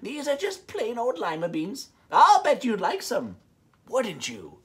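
put-on high, slow old woman's coax; sly old woman. Old woman tempting Camilla with beans, high and sly.

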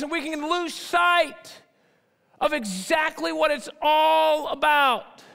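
a loud, high sermon build, slowing down at the end; full-force preaching. Acted. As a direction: preach it to the back row.

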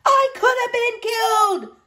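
very high loud roar. A narrator's reading. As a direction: roar indignantly. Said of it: "Sir Horace roared".

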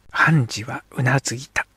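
plain reading voice

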